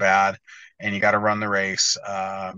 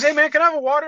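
much higher voice, louder, asking innocently; Hunter impression; 4 dB louder, much faster than usual. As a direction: squeak it out like the winner doesn't know.

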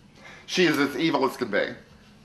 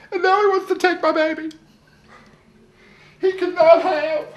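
high, whiny wail; distraught wailing; 11 semitones higher than usual. Acted. Wife wailing that he can't take her baby, high whiny plea. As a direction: plead like her baby is taken.

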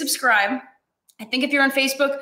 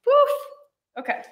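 ordinary delivery

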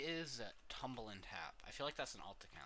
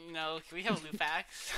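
high, loud, half laughing; loud laughing plea; 7 semitones higher, 12 dB louder than usual. Real, not acted. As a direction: beg through laughter.

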